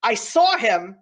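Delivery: ordinary talk, nothing marked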